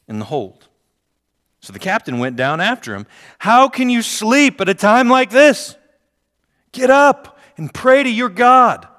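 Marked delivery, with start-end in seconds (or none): 3.4-8.9 shouted much higher and louder; captain's urgent shout. Acted. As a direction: yell him awake over the storm. Captain wakes Jonah in storm.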